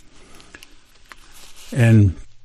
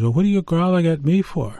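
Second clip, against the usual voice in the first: higher, indignant scolding; indignant Coyote; 7 semitones higher, faster than usual. Acted. Coyote scolds Grizzly Bear for growling at him, indignant.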